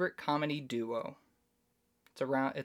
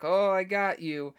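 loud, higher triumphant prank reveal; gleeful gotcha; 6 semitones higher, 7 dB louder than usual. Retold or imitated. Adam Sandler's character revealing his prank to her, loud gleeful gotcha. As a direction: crow over the prank.

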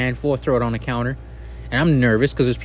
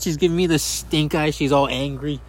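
ordinary talk, nothing marked

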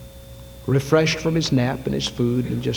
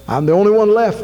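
higher and louder, crying out in despair; anguished outcry, much higher and louder; desperate cry; 9 semitones higher, 6 dB louder than usual. Retold or imitated. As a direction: throw the complaint up to heaven. Elijah's lament repeated.

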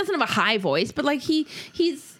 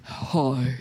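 much lower mock greeting; deep phone voice; 10 semitones lower than usual. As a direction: drop way down for a put-on phone hi. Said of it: "this deep voice".

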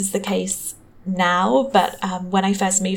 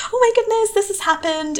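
flustered panic; 12 semitones higher than usual. Retold or imitated. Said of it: "call my dad and say".

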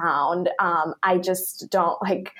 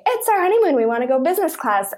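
excited clients; 8 semitones higher, faster than usual. Retold or imitated. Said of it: "come to us and say".

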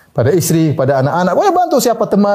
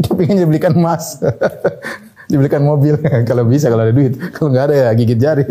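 amused chuckling; 3 semitones lower than usual. Real, not acted. Jokes about what wives want.

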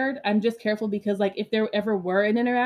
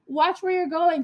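higher-pitched indignant call-out; tense, raised warning; retold scream; 8 semitones higher, 4 dB louder than usual. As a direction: call out a mock yell at a careless driver. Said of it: "So I screamed".

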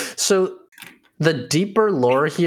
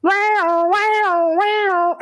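alarm siren; much slower than usual. Pretend. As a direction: wail it like an alarm. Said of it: "it's like".

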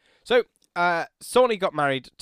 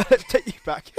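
laughing after choking; 11 semitones higher, 8 dB louder than usual. Real, not acted. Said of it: "I just choked on my drink a little bit there".

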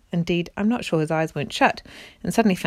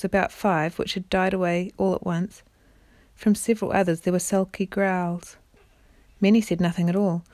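ordinary delivery

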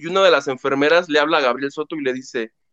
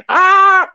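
high, loud happy scream; mock joyful shriek; 13 semitones higher, 8 dB louder than usual. Daughter seeing her husband return, loud high shriek. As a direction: shriek with relief. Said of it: "y así de".

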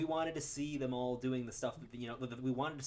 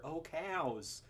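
higher-pitched mock-sad Southern accent; 5 semitones higher than usual. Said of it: "sad Southern accent".

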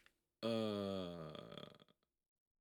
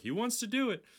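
much higher, louder mock yell; yelling to driver; 5 dB louder than usual. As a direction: call it out to the front of the limo. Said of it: "he yells to the driver".

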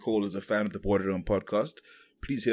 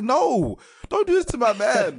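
high loud anguished plea; pleading cry; 7 dB louder than usual. Retold or imitated. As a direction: beg the show not to. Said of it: "I'm like".

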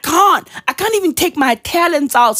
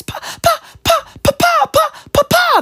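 put-on high, staccato child calling for papa; tiny high-pitched cries, one by one; crying kid. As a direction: plead in a squeaky little kid's voice. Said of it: "That's my kid".

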